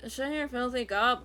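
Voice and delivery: loud and slow, only a hint of the squad leader; a half-formed attempt at his voice; first try at the voice. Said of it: "That's how he sounds".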